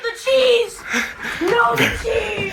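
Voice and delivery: laugh-wailing. Real, not acted. Cheese burning in microwave.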